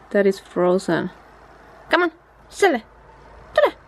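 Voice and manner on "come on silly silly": high dog-calling voice. Real, not acted.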